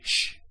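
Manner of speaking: unvoiced "shh" to quiet someone; hushing shush. Shown as a demonstration. Teacher showing the Shin as telling somebody to be quiet, hushing. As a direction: shush them with a rush of air. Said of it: "when you tell somebody to be quiet".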